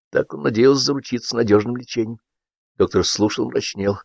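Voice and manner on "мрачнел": ordinary delivery, nothing marked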